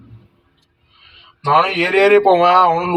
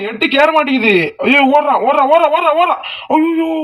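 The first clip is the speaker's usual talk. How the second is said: frantic alarm; 9 semitones higher than usual. Real, not acted. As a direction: panic as the enemy runs off. Enemy escaping.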